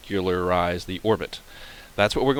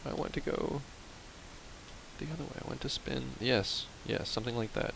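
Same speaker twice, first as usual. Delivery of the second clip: hushed concentration; 13 dB quieter than usual. Real, not acted. Quietly fighting the spin.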